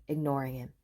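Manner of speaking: ordinary delivery, nothing marked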